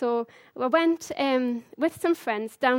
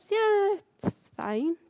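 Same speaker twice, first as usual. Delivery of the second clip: drawn-out, high-pitched mock reluctance; slow, hedging shrug in the voice; noncommittal; 7 semitones higher, much slower than usual. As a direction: imitate a very unconvinced yes, stretched slowly. Mimicking the man's reluctant approval.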